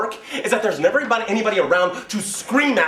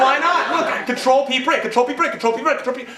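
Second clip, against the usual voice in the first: higher, louder pleading; frustrated user; 7 semitones higher, 5 dB louder than usual. Pretend. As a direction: insist loudly at the computer. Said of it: "trying to say".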